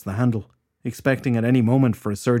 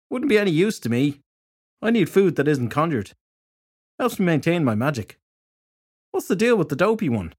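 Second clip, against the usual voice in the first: lightly voiced detective, pitched higher; matter-of-fact detective in a slightly raised pitch; blunt fairy detective; 6 semitones higher than usual. A narrator's reading.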